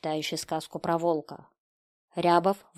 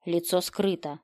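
ordinary delivery

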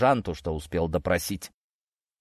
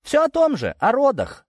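high, loud, needling reply; snide sisterly jab, pitched way up; 5 dB louder than usual. A narrator's reading. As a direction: tease him in a sing-out high voice. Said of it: "ехидно ответила сестра".

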